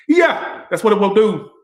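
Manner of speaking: very high, loud excited yelp; excited squeal. Real, not acted. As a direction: burst out high with glee. Excited about tarot reading.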